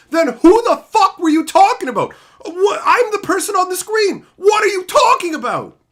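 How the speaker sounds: loud, high, incredulous yelling; exasperated outburst. Real, not acted. Ranting at commenters who claim it wasn't about him, loudly incredulous. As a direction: blow up at the excuse in disbelief.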